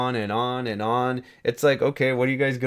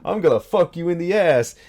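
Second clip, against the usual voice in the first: higher, theatrical villain line; quoting Lucifer dub; 5 semitones higher, 3 dB louder than usual. Retold or imitated. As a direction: deliver it like the dubbed demon. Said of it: "he said".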